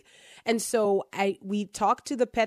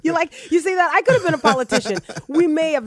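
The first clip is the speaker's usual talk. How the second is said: excited joking; 8 semitones higher, 8 dB louder, faster than usual. Real, not acted. Mocking her own spin.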